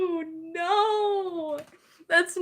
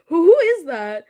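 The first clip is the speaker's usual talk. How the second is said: ordinary talk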